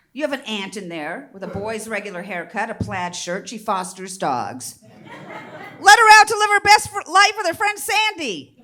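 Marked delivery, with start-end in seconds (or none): 4.8-8.6 loud, high urging; rally them to free her; laughing comic exhortation; rousing call. Real, not acted. Urging the crowd.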